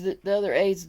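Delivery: plain reading voice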